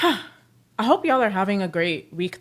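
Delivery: ordinary delivery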